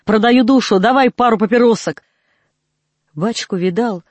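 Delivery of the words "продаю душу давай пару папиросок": loud desperate cry; shout. Acted. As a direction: call it out, bargaining hard. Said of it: "кричит Иуда".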